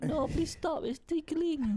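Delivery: very high squeal, being tickled; squeaky ticklish voice. Pretend. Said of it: "then be like".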